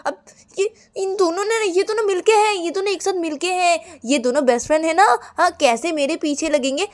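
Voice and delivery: high, loud complaint, easing down gradually; acted wounded indignation; aggrieved accusation. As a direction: protest as if ganged up on. Ananya accuses two friends of colluding.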